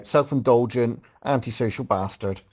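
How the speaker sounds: ordinary delivery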